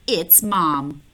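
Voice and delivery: usual speaking voice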